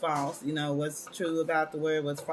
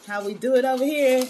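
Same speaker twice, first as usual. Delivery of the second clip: higher and louder, punchy hype; hyped catchphrase; 6 semitones higher, 7 dB louder than usual. Shown as a demonstration. Host hyping her Wellness Wednesday catchphrase, loud and high. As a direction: sell it like a show slogan.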